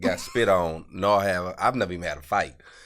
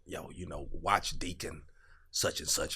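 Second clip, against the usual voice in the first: hushed tip-off; 4 semitones lower, 13 dB quieter than usual. Retold or imitated. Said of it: "they was like".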